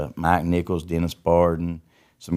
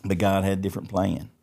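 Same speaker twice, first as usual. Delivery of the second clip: ordinary talk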